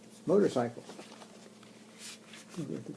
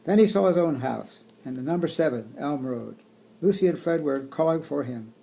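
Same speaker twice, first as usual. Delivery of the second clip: storybook reading voice; reading to children; 8 dB louder than usual. Acted. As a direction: read it aloud to kids. Reading picture book aloud.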